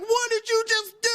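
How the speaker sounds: high-pitched, incredulous producer; imitated shocked disbelief. Producer to host after the hang-up, mock aghast. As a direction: act out an aghast producer demanding answers.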